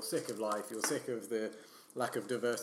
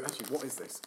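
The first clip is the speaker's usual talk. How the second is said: ordinary talk, nothing marked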